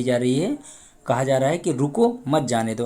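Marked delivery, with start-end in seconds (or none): 1.7-2.8 रुको cut off from मत जाने दो; pause after रुको. Shown as a demonstration. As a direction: halt briefly after रुको, then say मत जाने दो. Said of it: "अगर इसी को हटा देते हैं हम सकते को". (रुको पर रुककर, फिर मत जाने दो)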